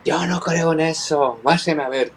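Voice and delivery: voz de médico enojado, más aguda y lenta; médico furioso. Retold or imitated. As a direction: imita al especialista indignado que lo despacha. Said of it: "Ese tipo se puso furioso conmigo". (el neurólogo rechaza el milagro, enojado y despectivo)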